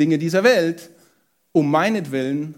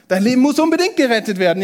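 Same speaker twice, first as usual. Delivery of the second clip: higher, louder and fast, pressing hard; urgent emphasis; 6 semitones higher, 4 dB louder, much faster than usual. Retold or imitated. Preacher voices Jesus' real message with urgent, louder insistence. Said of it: "Jesus sagt".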